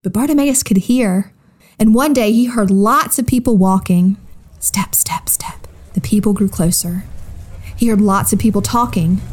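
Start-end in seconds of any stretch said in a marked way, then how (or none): 4.6-5.7 hushed, whispery sound of steps; whispered footsteps. A narrator's reading. Storyteller to children as a crowd walks closer, whispered. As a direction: whisper it like feet drawing near.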